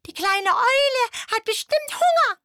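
very high, loud squeaky squirrel call; bright excited critter voice. Acted. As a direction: call out like an eager little squirrel. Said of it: "und rief".

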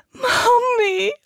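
very high, loud cry for mother; shrieking plea. Acted. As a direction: shriek for mommy. Terrified girl begs.

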